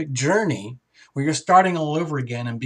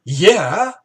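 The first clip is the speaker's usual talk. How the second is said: loud, high, rising cheers; the crowd shouting yes at the stunned Pisces; excited cheering; 7 semitones higher, 5 dB louder, much slower than usual. Pretend. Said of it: "we're all going".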